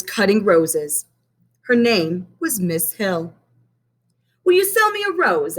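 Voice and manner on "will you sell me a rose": put-on high, eager little boy voice; young Benny asking. A narrator's reading. Benny asks Miss Hill for a rose, high and eager. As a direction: ask sweetly like a small boy. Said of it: "asked Benny".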